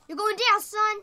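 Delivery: high, loud taunt; trash-talk the enemy. Acted.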